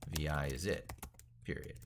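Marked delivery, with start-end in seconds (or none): none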